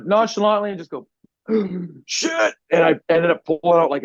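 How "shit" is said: high gasped shit; shocked gasp. Retold or imitated. Pulling hair out at dinner, high gasped shock. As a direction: breathe it out in sudden shock.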